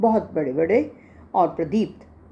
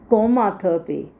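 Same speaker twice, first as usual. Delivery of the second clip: normal talking voice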